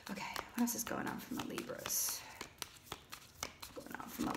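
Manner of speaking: hushed whisper to yourself; barely audible, breath-only murmur. Real, not acted. Reader asks the cards about the Libras, whispering.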